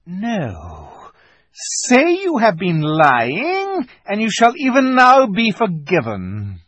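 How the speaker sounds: cold queen dangling forgiveness; menacing witch. Acted. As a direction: offer pardon as a threat.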